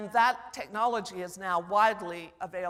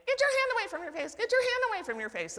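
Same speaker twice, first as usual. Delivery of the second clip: much higher, fast mock brother yell; hammed-up nagging sibling shout; brother screaming; 13 semitones higher, 3 dB louder, faster than usual. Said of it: "he would scream at me".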